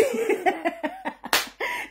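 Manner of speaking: a high giggle that fades off; giggling at a comment; high breathy giggle. Real, not acted. Giggling at a viewer's comment on her live.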